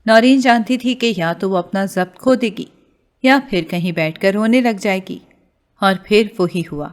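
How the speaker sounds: ordinary delivery, nothing marked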